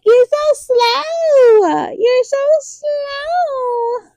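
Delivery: high, slow, dragged-out complaint; exasperated whine. Retold or imitated. Viewer scolding the slow prince, high drawn-out whine. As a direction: stretch each word out at the slow character. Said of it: "I'm like".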